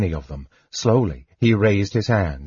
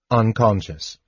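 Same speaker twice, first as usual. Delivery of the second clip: usual speaking voice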